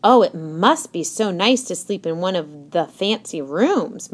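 plain reading voice

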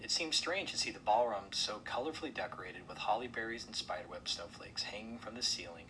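plain reading voice

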